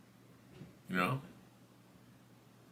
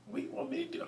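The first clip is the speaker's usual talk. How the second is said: small, high, very soft protest; mock helpless plea, high and hushed; defensive protest; 13 semitones higher, 14 dB quieter, much faster than usual. Storyteller answering the lady's mean look, meekly defensive. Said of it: "I'm looking at her like".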